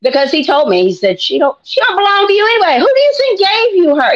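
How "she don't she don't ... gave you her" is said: higher, fast, scolding voice; the Lord scolding a mother who won't let go; in-your-face Lord. Retold or imitated. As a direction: get right in her face. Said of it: "he gets all in my face".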